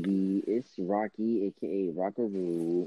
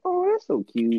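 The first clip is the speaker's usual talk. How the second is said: gushing high voice; 4 dB louder than usual. Retold or imitated. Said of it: "I was like".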